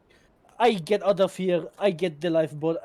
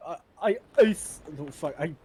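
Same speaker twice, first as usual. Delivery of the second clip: ordinary delivery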